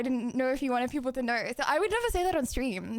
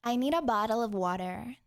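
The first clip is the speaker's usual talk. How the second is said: lower-pitched try at an American accent; 4 semitones lower than usual. Shown as a demonstration. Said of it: "can you try an American accent".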